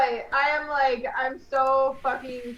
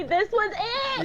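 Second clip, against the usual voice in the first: excited; 9 semitones higher than usual. Real, not acted. Celebrating the perfectly timed twenty.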